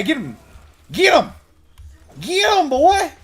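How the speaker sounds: very high excited yell; egging on the dog. Real, not acted. Player hyping his dog Kevin into the fight, very high. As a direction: scream at the dog to go for it.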